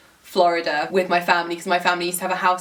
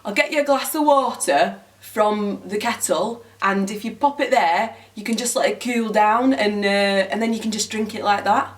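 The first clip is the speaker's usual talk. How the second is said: imitating a Northern English guy; Northern English accent. Northern guy on the train offering water, Northern English accent. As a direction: put on a Northern English accent, easy and helpful. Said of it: "there was a, a northern guy".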